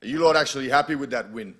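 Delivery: loud, high challenge to a team; stern team dressing-down. Acted. Acted team talk after a win.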